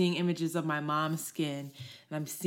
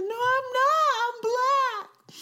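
put-on much higher, louder child's voice; insistent little girl; 5 dB louder, slower than usual. Small girl insisting to her teacher, high child voice.

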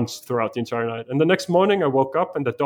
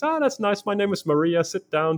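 put-on much higher, friendly older-woman voice; lightly voiced Maria; 7 semitones higher than usual. Maria welcoming the Danish traveller, high put-on voice. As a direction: lift it up high to voice her. Voicing Maria greeting him.

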